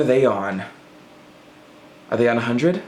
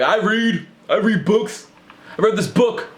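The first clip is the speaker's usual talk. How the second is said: bragging reader; 10 semitones higher than usual. Retold or imitated. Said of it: "they're like".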